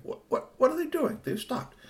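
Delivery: high, softening puzzled question; puzzled watcher. Acted. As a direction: wonder aloud in a high, baffled voice. Voicing puzzled watcher in tree.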